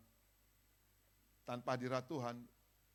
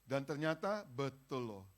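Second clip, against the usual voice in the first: ordinary delivery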